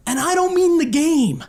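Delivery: high, pointed heckle; taunting follow-up. Retold or imitated. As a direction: drive the jeer home to the rival fan. Finishing his taunt at the stands.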